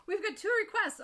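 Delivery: gasp, then quick, high and loud; high-pitched thrilled exclamation; excited surprise. Real, not acted. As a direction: echo the news with loud delight. Delighted echo of news of requests.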